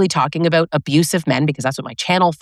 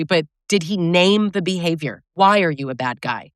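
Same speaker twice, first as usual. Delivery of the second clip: usual speaking voice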